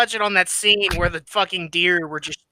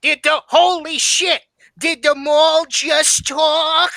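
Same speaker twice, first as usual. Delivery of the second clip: mock sister voice; 7 semitones higher, slower than usual. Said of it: "Whitey's gross sister goes".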